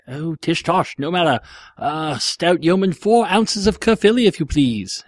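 high, put-on comic customer; theatrical, mannered shopper; cheese shop customer.

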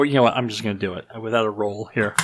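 ordinary talk

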